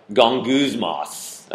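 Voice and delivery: "Gonguzmos" said very slowly, savoring each syllable; Gonguzmos drawn out. Shown as a demonstration. Preacher relishing the ugly sound of "Gonguzmos". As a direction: stretch out "Gonguzmos" like it tastes bad. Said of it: "it just sounds bad".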